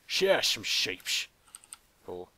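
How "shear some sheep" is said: louder, odd put-on voice for a cheer; silly put-on voice. Player cheers about shearing sheep in a goofy louder voice. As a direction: belt it out in a goofy voice. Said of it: "I don't know why I was talking like that".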